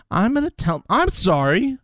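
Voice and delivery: high-pitched mocking impression of Cash; squeaky mocking imitation of a rival; mock high whine.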